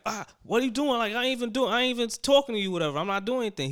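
high-pitched mock indignation; retold indignant denial. Retold indignant denial during argument.

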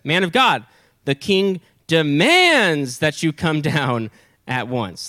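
slow, drawn-out stern command. Acted. Second captain ordering Elijah down, stern and emphatic. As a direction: stretch out DEMANDS with heavy authority. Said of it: "now listen to how he says it".

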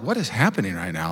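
soft, low disbelief; stunned hush. Retold or imitated. Kid amazed at another family's dinner, soft and low. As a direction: murmur it in quiet shock.

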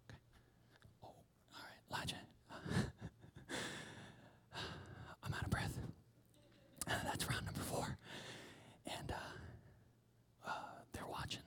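hushed and out of breath; whispered, winded. Acted. Servant back from the lookout, out of breath, whispering. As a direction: whisper like you ran the hill.

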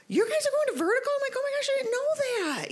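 high, loud squeal of surprise; retold surprise. Hearing her coworker goes to her church, high surprised squeal. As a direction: act shocked and delighted, pitch way up.

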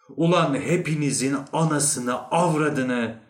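loud outburst of cursing; exasperated curse. Acted. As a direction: curse the lot of them loudly. Narrator finally curses everyone himself.

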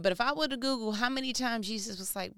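usual speaking voice

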